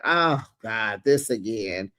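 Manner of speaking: low, weary acted groan of words; eye-rolling exasperation. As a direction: drop the pitch as if fed up. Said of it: "Wendy is rolling her eyes like".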